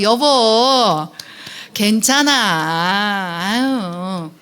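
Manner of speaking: extremely stretched, comforting call; soothing demo. Shown as a demonstration. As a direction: draw it right out to model comforting a spouse. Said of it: "자, 한번 연습해 볼까요?". (아주 길게 늘여 다독이듯)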